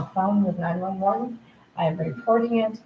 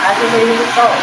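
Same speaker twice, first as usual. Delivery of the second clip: shout; 5 semitones higher, 10 dB louder than usual. Real, not acted. Angry report of attacks.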